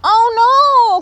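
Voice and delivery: high, loud alarmed cry. A narrator's reading. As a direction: cry out in fright. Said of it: "cried Red Squirrel".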